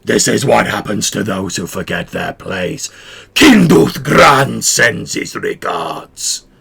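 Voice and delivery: loud, slow threatening lizard voice; reptilian thug shout. Acted. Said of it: "One of them shouts".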